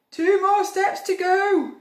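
high, loud crook's call; crook Hugh. A narrator's reading. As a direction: call it out as a thief nearly there. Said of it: "said Hugh".

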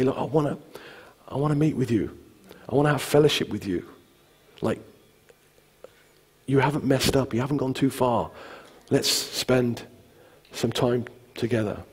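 soft, gentle invitation; understated, intimate comfort; reassuring. Retold or imitated. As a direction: reassure quietly, with pauses. Jesus reassuring those who failed.

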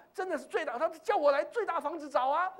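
usual speaking voice

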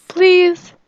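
high, loud begging; pleading. Pretend. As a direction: beg insistently on her behalf. Begs game character again for hug.

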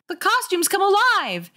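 high, alarmed exclamation; frightened. A narrator's reading. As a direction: cry out in acted panic. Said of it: "That was Carly Beth's first frightened thought".

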